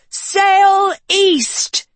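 high, drawn-out shout. A narrator's reading. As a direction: bellow the order at the wheel. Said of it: "she shouted at the wheel".

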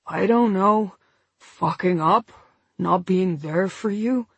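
weary man talking through anaesthetic; slurred numb lip. A narrator's reading. Injured man apologising to partner, slurred numb lip. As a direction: let a numb lip thicken the words. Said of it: "making it hard to talk".

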